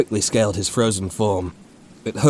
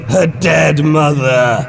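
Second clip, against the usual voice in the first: "Her dead mother" shouted, slow and higher; screaming accusation; 7 semitones higher, 9 dB louder, much slower than usual. A narrator's reading. Creature accuses the soldier.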